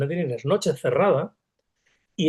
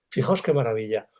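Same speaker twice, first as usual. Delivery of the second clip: ordinary talk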